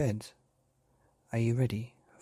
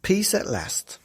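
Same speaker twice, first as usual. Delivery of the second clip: title called out high and loud; announced title; 9 semitones higher, 6 dB louder, slower than usual. A narrator's reading. Narrator announcing the title to children, high and bright.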